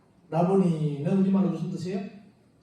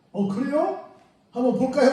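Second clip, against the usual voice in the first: high, louder curious reaction; mildly surprised disciple; 7 semitones higher, 5 dB louder than usual. Acted. Other disciple deciding to look in the tomb, mock surprise. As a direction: voice a disciple's sudden interest, pitched up.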